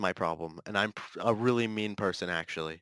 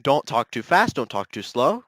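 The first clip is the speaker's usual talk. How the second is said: normal talking voice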